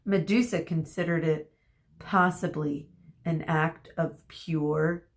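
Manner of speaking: ordinary delivery, nothing marked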